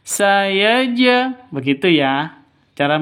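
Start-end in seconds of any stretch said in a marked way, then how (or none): 0.0-1.5 bounced jim in sayaja, chanted high; qalqalah on jim. Shown as a demonstration. Teacher shows how to read it, jim bounced in sayaja. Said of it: "Makanya dipantulkan ke hurufnya".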